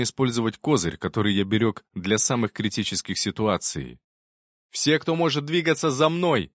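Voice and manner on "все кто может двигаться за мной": rally the squad with a command; commanding shout. Acted. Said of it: "скомандовал я".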